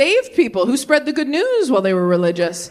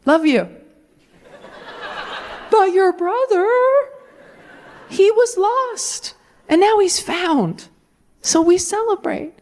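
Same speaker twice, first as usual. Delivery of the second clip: doting dad; 9 semitones higher, slower than usual. Retold or imitated. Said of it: "And the dad is like".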